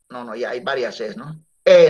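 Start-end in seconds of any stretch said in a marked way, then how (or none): 1.6-1.9 E cut short and loud; a single dry "E", not stretched. Shown as a demonstration. (E seca, corta y fuerte)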